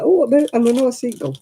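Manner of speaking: excited. Retold or imitated. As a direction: exclaim like spotting something rare. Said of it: "I'm like".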